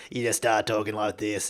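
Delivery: putting on a broad Bogan voice; demonstrating a mock Bogan accent. Shown as a demonstration. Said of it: "I've been doing that voice".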